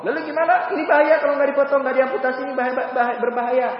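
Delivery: high, urgent worried protest; acted alarm. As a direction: fret aloud as the alarmed doctors. Doctors alarmed in retold amputation story.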